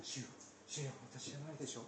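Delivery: quick, hushed, a little distant; group murmur. Retold or imitated. Disciples murmuring around the table, quick and hushed. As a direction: let it ripple round the table. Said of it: "ずらっとその声が".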